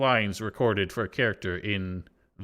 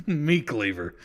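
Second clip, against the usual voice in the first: laughing imitation; slower than usual. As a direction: chuckle through a mock villain line. Imitates the game voice line, laughing.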